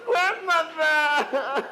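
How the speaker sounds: very slow, stretched wail breaking into laughter; drawn-out laughing wail. Acted. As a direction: drag the word out, laughing.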